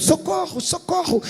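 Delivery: high, panicky cry for help; mock cry for help. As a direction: call out like drowning men. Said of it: "eles estão gritando".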